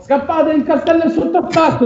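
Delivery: high-pitched panicked town-crier yell; acted shout of alarm; urgent crowd-warning shout.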